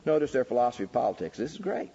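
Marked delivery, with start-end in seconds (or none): none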